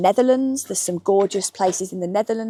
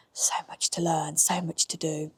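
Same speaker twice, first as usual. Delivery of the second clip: soft breathy aside under her breath; whispered aside; 2 semitones lower, 8 dB quieter than usual. Real, not acted. Vlogger sighing to viewers about learning, whispered aside. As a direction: murmur it to yourself, overwhelmed.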